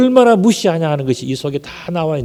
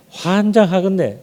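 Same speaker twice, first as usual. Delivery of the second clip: slow, higher Jeolla-accented exasperation; Jeolla dialect; 4 semitones higher, slower than usual. Shown as a demonstration. Peter answering the servant's accusation, slow exasperated Jeolla accent. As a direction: stretch it with a Jeolla lilt. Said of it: "전라도 말은 뭔가?".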